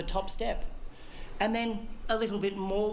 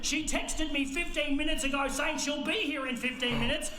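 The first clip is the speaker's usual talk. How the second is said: higher, rushed urgency; urgent panic; 5 semitones higher, 6 dB louder than usual. Retold or imitated. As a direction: shout the last bit.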